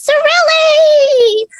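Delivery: high, slow, loud gleeful squeal of a name; childlike squeal of glee. Acted. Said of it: "squeal with, with childlike glee".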